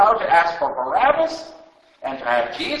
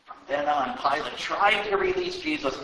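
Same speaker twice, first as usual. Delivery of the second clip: ordinary delivery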